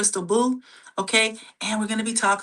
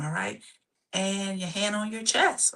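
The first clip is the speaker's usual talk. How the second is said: ordinary talk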